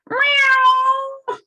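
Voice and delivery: very high squeaky meow; tiny high kitty meow; cat meow. Acted. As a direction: mew like a startled kitten. The cat in the story.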